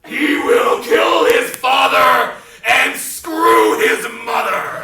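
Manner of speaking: slow, loud booming oracle; voice from on high. Acted. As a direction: boom down from the heavens. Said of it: "a voice from on high said".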